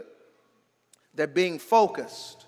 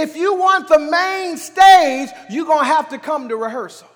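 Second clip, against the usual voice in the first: high, loud pulpit exhortation; fervent preacher, voice raised; full-force preaching; 10 semitones higher, 8 dB louder than usual. Real, not acted. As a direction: press the congregation hard, point after point.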